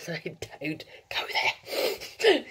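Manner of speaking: slow, breathy laughing aside; laughing whisper. Real, not acted. Admitting to viewers she lost fluency, laughing whisper.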